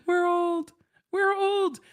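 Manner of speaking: high-pitched playful lament; mock wail. Real, not acted. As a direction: squeal it in comic horror. Dismay at Shrek's age.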